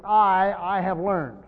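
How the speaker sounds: first "I" loud and held, then "I have learned"; emphatic doubled I. Shown as a demonstration. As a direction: hit the first "I" hard, then repeat it into the phrase. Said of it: "it would be like this".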